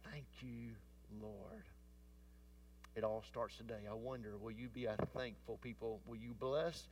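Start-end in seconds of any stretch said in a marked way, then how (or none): 0.0-1.6 hushed thanks. Shown as a demonstration. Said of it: "and say".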